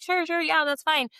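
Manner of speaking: high, careless quick agreement; mock carefree teacher brushing them off; breezy yes. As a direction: toss off a distracted yes. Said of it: "and I'm like".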